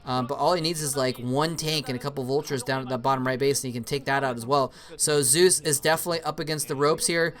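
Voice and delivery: usual speaking voice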